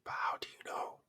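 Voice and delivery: sudden hushed whisper; a whispered doubt. Real, not acted. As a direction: drop to a breathy whisper. Rhetorical question, dropped to a whisper.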